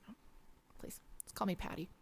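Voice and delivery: soft unvoiced whisper, friendly; whispered reply. Bank teller whispering back to the frog, gently. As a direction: hush it, kindly.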